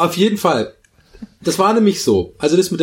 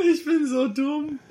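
laughing too hard to breathe, high and slow; helpless giggling fit, gasping between words; breathless laughter; 13 semitones higher than usual. Real, not acted. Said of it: "krieg keine Luft mehr".

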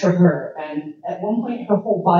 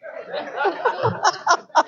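high laugh; 10 semitones higher than usual. Real, not acted. Laughing at own bit.

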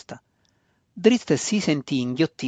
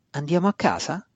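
higher-pitched, timid asking; tentative question; 5 semitones higher than usual. A narrator's reading. Brother daring to ask his sister about home, tentative. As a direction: venture the question cautiously. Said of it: "osò chiedere lui dopo un po'".

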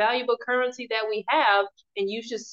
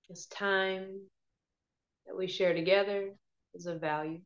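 guided meditation; 5 dB quieter than usual. Acted. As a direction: ease them gently into calm.

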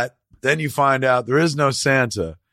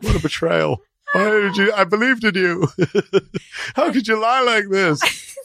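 high, hammed-up hurt voice with laughs breaking through; mock betrayed wail; 8 semitones higher than usual. Pranked friend wails at the prankster in mock betrayal. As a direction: play the wounded victim of a prank, half laughing. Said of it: "I'd cry".

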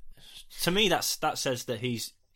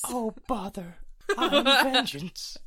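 much higher, very slow bear voice, laughing; high, slow, giggly Pooh doing Batman; 11 semitones higher, much slower than usual. Pretend. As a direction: drawl the threat like a dozy cartoon bear. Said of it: "he's gonna be like".

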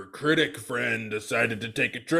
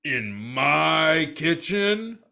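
slow indignant; 4 semitones higher, 3 dB louder, much slower than usual. Acted. Chef objects to boss.

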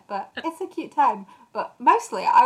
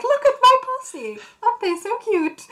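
very high, louder, gushing Esme voice; imitating Esme; 5 dB louder than usual. Esme shows off her vampire deer, high gushing coo. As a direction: coo it up high like a proud mother. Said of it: "And she's like".

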